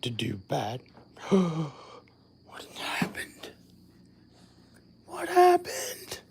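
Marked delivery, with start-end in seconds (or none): 2.5-3.5 startled unvoiced whisper; hushed startled question. Real, not acted. 5.1-6.2 much higher, slow, drawn-out question; high bewildered repeat; surprised. Real, not acted.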